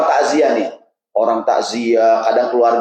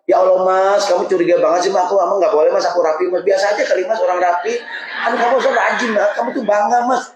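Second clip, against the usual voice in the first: high, fast complaining wife's voice, half laughing; a wife's long, whining answer to one short question; whiny wife; 6 semitones higher than usual. Acted. Preacher voices a wife answering her husband.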